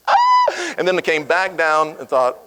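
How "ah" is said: very high, loud out-of-body scream; disbelieving shriek. Retold or imitated. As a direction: shriek like you've left your body. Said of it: "went up into that corner of the room and went".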